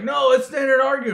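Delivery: high, loud protest; mock arguing. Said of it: "And everybody's like".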